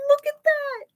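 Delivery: very high shout of alarm; high startled cry. Real, not acted. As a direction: gasp at the picture. Teacher reacting to chase scene.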